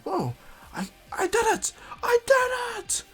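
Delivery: very high, slow, yelled triumph; excited shout. Retold or imitated. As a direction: scream with disbelieving joy. Naruto thrilled his jutsu worked.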